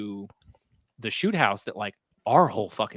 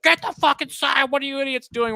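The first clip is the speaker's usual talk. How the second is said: very high, louder mock-panic shout; panicked yelling; 5 dB louder than usual. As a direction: imitate frantic comrades yelling orders. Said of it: "everyone else is panicking".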